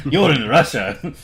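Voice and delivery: bad attempt at a Russian accent; a half-hearted, badly faked Russian accent. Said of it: "I can't do a Russian accent".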